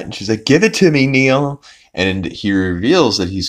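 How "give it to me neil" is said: raised-pitch insistent demand; mildly exasperated retold order; mock demand. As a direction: imitate someone demanding it back. Meg demanding again, more insistent.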